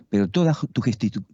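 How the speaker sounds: normal talking voice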